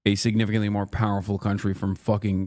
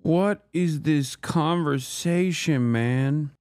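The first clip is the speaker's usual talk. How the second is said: slow, drawn-out, higher-pitched incredulity; baffled disbelief; 4 semitones higher, much slower than usual. Real, not acted. Streamer reacts to grim plague talk, drawn-out disbelief. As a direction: stretch the words out in real bewilderment.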